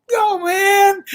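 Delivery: a very high, sulky whine; pouting kid. Retold or imitated. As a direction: refuse like a sulking child. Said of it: "pouting off".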